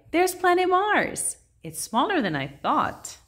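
excited cry. A narrator's reading. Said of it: "Then Sadie cried".

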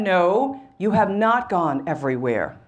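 low, firm repeated correction from God. Retold or imitated. God insisting to a job seeker, voice of God. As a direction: refuse it as God, low and final. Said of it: "the voice of God".

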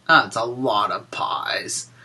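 ordinary talk, nothing marked

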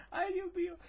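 high, soft squeak through laughter; helpless laughter. Real, not acted. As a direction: squeeze the words out while cracking up. Overcome by the silly dialogue. (muerto de risa, con voz aguda)

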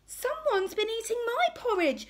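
high-pitched indignant mother bear; affronted, high bear voice; Mummy Bear. A narrator's reading. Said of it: "rumbled Mummy Bear".

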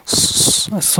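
long hissed স- opening into a full স; Sad sound demo. Shown as a demonstration. Teacher showing learners how Sad sounds, স- held as a hiss. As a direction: hold the hiss of স-, then release it as স. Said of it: "এরকম শব্দ করতে হবে".

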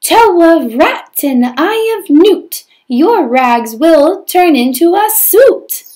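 high, loud chanted spell with slow rhyming lines; fairy spell. Acted. Fairy chanting magic words over Prince Cinders, high and loud. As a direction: cast the spell like a bungling fairy, big and loud.

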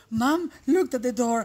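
ordinary delivery

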